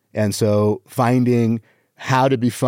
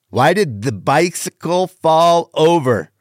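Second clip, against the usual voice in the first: higher, slow hammed-up riddle; exaggerated joke setup; 5 semitones higher than usual. Shown as a demonstration. Comic focusing on delivery, exaggerated slow setup. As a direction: draw the question out, pitched up. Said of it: "on the delivery".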